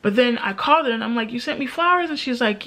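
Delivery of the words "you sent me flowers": high-pitched, surprised query; retold disbelief, pitched well up; surprised question. Friend calling about unexpected flowers, surprised question.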